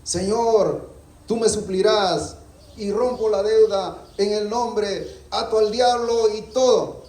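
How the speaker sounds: high, slow, fervent prayer. Retold or imitated. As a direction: rebuke the debt like a prayer warrior. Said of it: "cuando oramos decimos".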